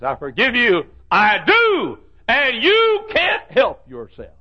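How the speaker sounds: high, loud, slowing to a drawn-out cry; impassioned shout. Retold or imitated. Preacher shouting a quoted forgiveness. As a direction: preach the forgiveness at full force. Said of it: "Charles Dickens forgave and said".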